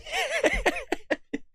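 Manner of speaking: a sudden high scoffing laugh; short derisive laugh; high mocking laugh. Real, not acted. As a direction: cackle at how absurd it is. Laughs at his own point.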